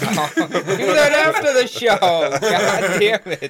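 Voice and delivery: high, loud, slow laughing scold. Real, not acted. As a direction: scold while cracking up.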